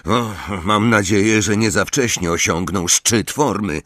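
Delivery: plain reading voice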